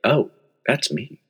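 hushed, slow realization; a soft stunned oh; quiet surprise. Retold or imitated. Spotting himself on a magnet, quietly stunned. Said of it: "I was like".

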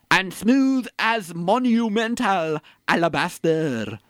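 slow, grand verse reading; hammed-up verse, very slow; slow Shakespeare recital. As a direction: declaim the lines slowly like a stage actor. Reciting Othello lines.

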